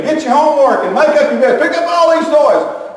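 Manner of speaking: high, loud mock scolding; grandma hollering at the grandkids, high and loud; imitating a hollering grandma. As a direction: bark orders like a frazzled grandma. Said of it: "Terry was saying".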